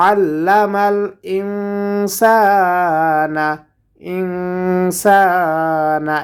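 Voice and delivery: ইনসানা with the ন hidden in a long nasal hum; nasal ikhfa. Shown as a demonstration. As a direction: hold the ng of ইনসানা through the nose. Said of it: "এখানে গুণনা হবে". (ইনসানা শব্দে নাকের ভেতর লুকিয়ে গুন্নাহ)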